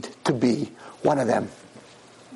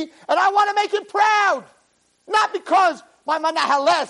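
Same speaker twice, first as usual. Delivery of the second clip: fiery preaching; 11 semitones higher than usual. Acted. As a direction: preach it with full fire. Rabbi preaches at full force.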